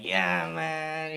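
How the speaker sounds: put-on [name] accent. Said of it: "I'm clearly doing some [name] shit".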